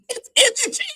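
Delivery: said through a high, squealing laugh; squeaky laugh. Real, not acted. Laughing at the rivals' hypocrisy.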